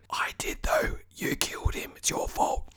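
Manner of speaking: hushed, voiceless mock accusation; accusing whisper. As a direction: whisper it like a guilty conscience. Mock accusing aside after denying blame.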